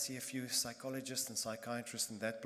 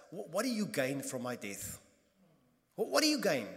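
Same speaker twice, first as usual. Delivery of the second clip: loud, higher, furious demand at God; angry; 3 semitones higher, 7 dB louder than usual. Retold or imitated. Psalmist confronting God over his death, loud and angry. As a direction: hurl the questions up at heaven in rage. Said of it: "he's angry at God".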